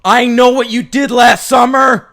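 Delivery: loud, high shouted threat; over-the-top high shout; freakish shout. Acted. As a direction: yell the note like a freak. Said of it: "It sounds like a freak".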